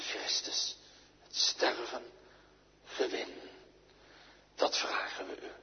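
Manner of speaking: prayer. Acted. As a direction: pray it humbly before God. Closing prayer.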